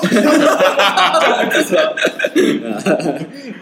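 high rising burst of laughter; loud laughter. Real, not acted. Laughing at the ad bit.

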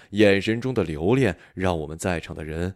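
ordinary delivery

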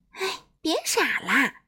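put-on a playful chiding from a sunny girl; cheerful Miss Sunshine. A narrator's reading. Miss Sunshine teases the crying king, playfully chiding. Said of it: "阳光小姐说完".

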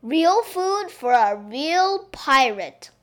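slow, loud chef's presentation; grand flourish. A narrator's reading. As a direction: unveil the dish proudly, drawing it out. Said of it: "cried the chef with flourish".

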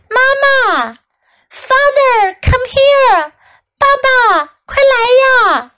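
put-on high, urgent call of a scared child; crying out. A narrator's reading. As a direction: call for your parents in a high frightened voice. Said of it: "阿曼达大叫起来".

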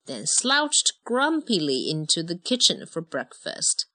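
ordinary delivery, nothing marked

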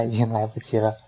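ordinary delivery, nothing marked

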